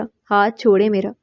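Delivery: ordinary delivery, nothing marked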